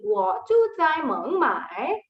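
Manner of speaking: slow sing-song 我住在孟买 with exaggerated tone contours; almost sing 我住在孟买, tones rising and falling; 我住在孟买 chanted slowly with the tones drawn out. Shown as a demonstration. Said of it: "It's almost like I'm singing".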